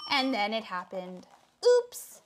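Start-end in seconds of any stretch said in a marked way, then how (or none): 1.6-2.2 high, loud little "oops"; spluttered oops. A narrator's reading. Froggy splutters after something happens underwater, high oops. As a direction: let it pop out pitched up, lightly startled. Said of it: "spluttered, uh, Froggy".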